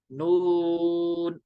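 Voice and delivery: "नून" stretched on a steady oo; three-alif elongation. Shown as a demonstration. Teacher repeats the letter for students, drawn out. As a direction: sustain the vowel of "नून". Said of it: "तीन अलिफ खींचेंगे".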